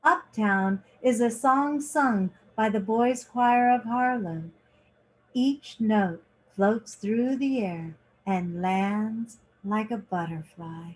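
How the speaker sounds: lively picture-book reading, lingering on each page; reading to children. A narrator's reading. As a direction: read it like story time for kids. Reads picture book aloud.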